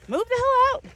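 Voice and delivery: high, loud get-out shout; heated ultimatum; angry shout. Real, not acted. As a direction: shove them out the door.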